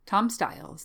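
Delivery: ordinary delivery, nothing marked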